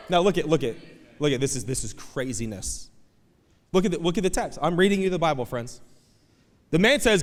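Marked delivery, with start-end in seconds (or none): none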